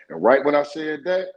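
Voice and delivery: normal talking voice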